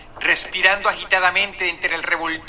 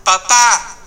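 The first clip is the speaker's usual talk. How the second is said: calling out; 3 semitones higher, much slower than usual. A narrator's reading. Frightened boy on an emergency call shouts for his parents, mildly raised call. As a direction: call out to missing parents, lightly voiced. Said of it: "llamar en voz alta".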